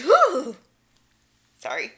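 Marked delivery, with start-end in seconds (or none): 0.0-0.6 high, loud whoo cry; imitating Aaron's whoo. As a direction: yelp it like Aaron. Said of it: "Except for Aaron's".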